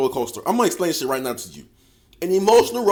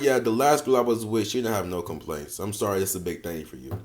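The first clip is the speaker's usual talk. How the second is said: much lower and softer, dry and cocky; a cool put-down tossed off quietly; smug low comeback; 8 semitones lower, 7 dB quieter than usual. Retold or imitated. Guy answering the short joke, low smug comeback. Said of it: "you could be like".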